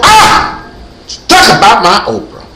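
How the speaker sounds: high, loud cry of outrage; defensive outburst. Acted. Defending my Oprah from haters, high mock outburst. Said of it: "that's what I go".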